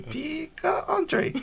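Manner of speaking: high, very slow squeaky Pikachu call. Retold or imitated. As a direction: drag it out like Pikachu. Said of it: "He was like".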